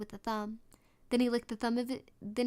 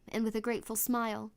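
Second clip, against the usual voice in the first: ordinary delivery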